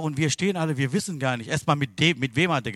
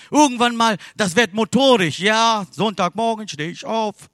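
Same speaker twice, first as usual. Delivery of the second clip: raised, emphatic sermon voice; full-force preaching; 7 semitones higher, 7 dB louder than usual. Real, not acted. Preacher urging congregation out of routine, full-force preaching. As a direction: punch out each phrase to the congregation.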